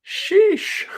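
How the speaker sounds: ordinary talk, nothing marked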